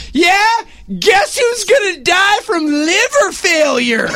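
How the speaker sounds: loud, high taunting yell; boy shouting trash talk at drunk fans. Retold or imitated. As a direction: taunt them across the row. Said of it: "Jack looks at these boys and goes".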